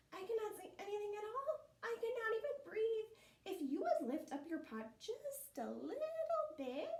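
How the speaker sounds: high, rushed squirrel plea; pleading squirrel. Acted. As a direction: squeak out a plea while pinned down.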